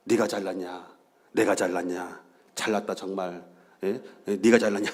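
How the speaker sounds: low, sulky muttering bicker; grumbling. Retold or imitated. Husband imitating the couple's bickering in the car, low sulky grumble. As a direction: grumble it under your breath. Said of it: "투덜거렸어요".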